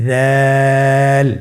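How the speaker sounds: দাল with the vowel held three counts; Arabic letter with three alif lengthening; three alif stretch. Shown as a demonstration. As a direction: draw দাল out long and thin. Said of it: "আমার সাথে বলুন".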